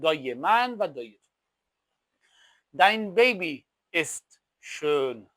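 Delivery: ordinary delivery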